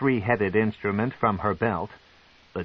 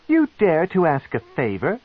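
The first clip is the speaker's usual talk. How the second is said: louder, affronted matron; indignant; 8 semitones higher, 5 dB louder than usual. Acted. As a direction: balk at his nerve.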